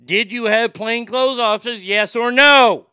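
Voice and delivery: escalating pretend grilling, ends in a shout; pretend hearing, angry. As the congressman grilling the witness, loud and angry. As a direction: build to a yell on yes or no. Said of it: "and I go".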